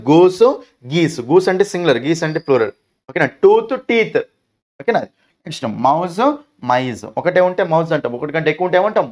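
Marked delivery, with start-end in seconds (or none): none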